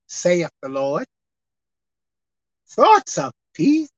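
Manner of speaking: phrase by phrase, long pauses in between; slow reading. Shown as a demonstration. Preacher repeating God's words to let it sink in, slowly. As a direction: read very slowly, letting each phrase sink in. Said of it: "one more time slow".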